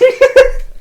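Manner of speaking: loud high-pitched laugh; high burst of laughter. Real, not acted. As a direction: crack up in a shriek. Laughing about friend falling.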